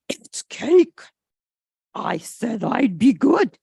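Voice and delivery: loud, excited thought voice; a dog torn over cake; tempted George. A narrator's reading. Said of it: "George thinks".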